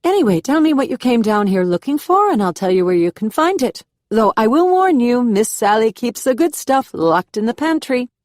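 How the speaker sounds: put-on South American Southern accent. A narrator's reading. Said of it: "a South American cadence slowed with a Southern drawl".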